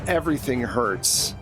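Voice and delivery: ordinary talk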